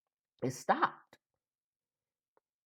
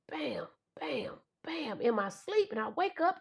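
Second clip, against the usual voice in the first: ordinary talk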